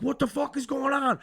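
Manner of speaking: high, startled retold demand; acted-out startled outburst; baffled alarm. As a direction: demand it in high-pitched confusion.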